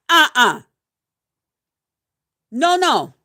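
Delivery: loud, firm uh-uh; loud flat refusal. Real, not acted. Refusing beggars in their fifties, loud firm no. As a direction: shut it down hard.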